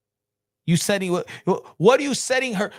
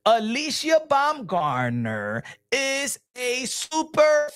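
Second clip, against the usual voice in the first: exaggeratedly slow, drawn-out explanation; much slower than usual. Shown as a demonstration. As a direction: spell it out slowly for a slow listener. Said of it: "Let me say it slow for this gentleman".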